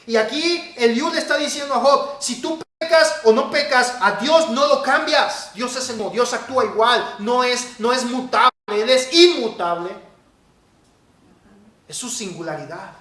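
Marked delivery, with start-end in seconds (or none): 0.1-9.9 loud, high, forceful preacher; emphatic preaching. Real, not acted. Preacher to congregation on God's sovereignty, forceful preaching. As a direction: drive it home with raised voice.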